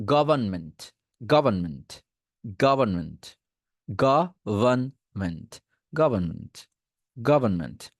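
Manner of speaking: গভর্নমেন্ট as go-ban-ment, r dropped; government without r. Shown as a demonstration. Teacher repeating গভর্নমেন্ট without the r. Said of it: "আরটাকে বাদ দিয়ে দেন".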